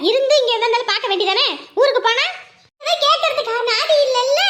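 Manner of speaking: normal talking voice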